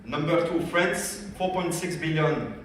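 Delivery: normal talking voice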